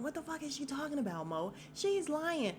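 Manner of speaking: high, fast retold yell without the volume; mock yell. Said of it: "So Lorenzo is yelling".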